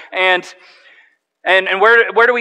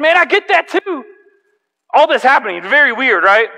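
sympathetic; 8 semitones higher, 6 dB louder than usual. Retold or imitated. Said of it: "I said".

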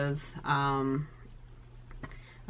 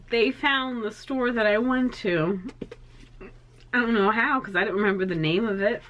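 tearful amazement; 6 semitones higher than usual. Real, not acted. Overwhelmed by the gift.

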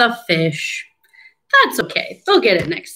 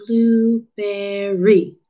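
"Blueberry" very slow, each syllable apart; much slower than usual. Shown as a demonstration. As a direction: stretch "Blueberry" into three separate counted beats. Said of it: "Three syllables".

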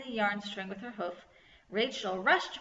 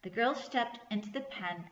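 ordinary delivery

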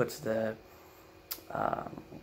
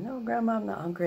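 grandson refusing; 12 semitones higher, 4 dB louder than usual. Retold or imitated. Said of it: "I said".

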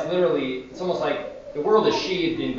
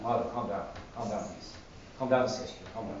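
softly hushed, lower-pitched reassurance; calm them down in a low, soft voice; soothing; 4 semitones lower, 10 dB quieter than usual. Retold or imitated. Saint settling the upset nun in his cell, soothing.

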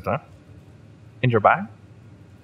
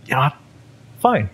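a louder, higher snap of "Fine"; fed up; 7 semitones higher, 5 dB louder, faster than usual. Acted. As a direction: give up on being nice. Loses patience with captive.

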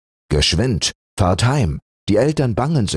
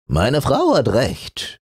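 lightly voiced old husband; 8 semitones higher than usual. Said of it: "sprach der türkische Schlafrock".

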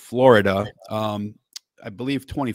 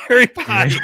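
"Harry Potty" blurted high and loud through laughter; laughing shout; 12 semitones higher, 10 dB louder than usual. Pretend. Host cracking himself up over a silly name, laughing shout.

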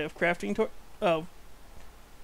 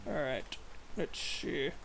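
low, quiet undertone mutter; said low under the breath; 4 semitones lower, 11 dB quieter, much slower than usual. Real, not acted. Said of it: "in an undertone voice".

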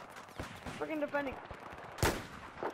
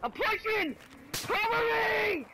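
high urgent call to teammate; cover me shout; 7 semitones higher, much slower than usual. Real, not acted. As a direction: yell it out, drawn slow. Calling to teammate while pushing.

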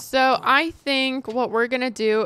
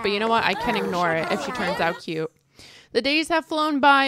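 ordinary delivery

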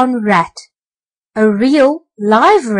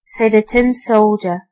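ordinary delivery, nothing marked

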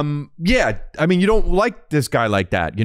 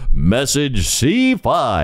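very slow, drawn-out recorded announcement; automated phone message; 2 semitones higher, much slower than usual. Retold or imitated. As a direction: mimic an old landline recording. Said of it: "it would say".

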